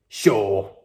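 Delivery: ordinary delivery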